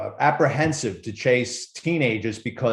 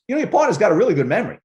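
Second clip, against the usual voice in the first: quick, raised and louder wisecrack; sarcastic suspect; 7 semitones higher, 5 dB louder, much faster than usual. Retold or imitated. Arrested thief joking to the partner, fast and loud.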